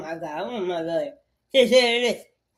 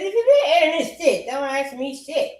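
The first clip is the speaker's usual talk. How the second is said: laughing quote; 6 semitones higher, 4 dB louder than usual. Retold or imitated. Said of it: "Then I said".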